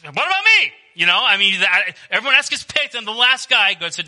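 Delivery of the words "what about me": high, loud mock whine of the unpicked kid; hammed-up left-out kid; mock whiny kid. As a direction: squeak it out like the kid nobody picks.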